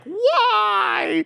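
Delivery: a high, loud, wailing why; anguished scream. Retold or imitated. Fan screaming at the Isabelle announcement, high mock wail. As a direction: cry out in mock despair like a cheated fan. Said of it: "screaming when they announce Isabelle".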